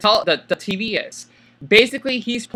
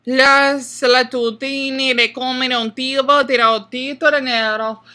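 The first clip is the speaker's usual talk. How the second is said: put-on Italian accent; 6 semitones higher, 3 dB louder than usual. Mock Italian gibberish intro.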